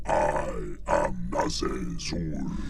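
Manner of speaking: slow, detuned deep demon. Shown as a demonstration. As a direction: drag it out low and menacing. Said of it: "has to be deeper".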